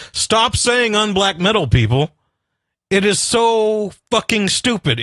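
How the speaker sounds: fed-up ranting at people; exasperated rant. Real, not acted. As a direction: scold the listeners in disbelief. Ranting at unblack metal fans.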